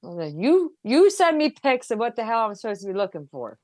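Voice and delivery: ordinary talk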